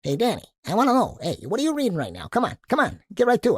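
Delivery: high, loud, pushy Joe Pesci-type patter; Mikey pestering Danny from the front seat, Joe Pesci-style. Retold or imitated. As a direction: needle Danny like a Joe Pesci tough guy. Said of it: "who talks like Joe Pesci".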